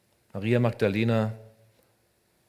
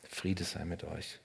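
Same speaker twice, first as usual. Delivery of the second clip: gentle blessing; 4 semitones lower, 8 dB quieter, slower than usual. Acted. Jesus grüßt die Jünger.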